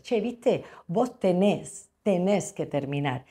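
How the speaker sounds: "Che, ¿viste?" agudo y "vos tenés" lento, al estilo porteño; acento argentino. Shown as a demonstration. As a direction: imita a un argentino con melodía marcada. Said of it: "En Argentina dirían". (con acento argentino, cantarín y alargado)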